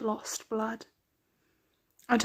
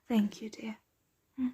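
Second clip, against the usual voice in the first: ordinary talk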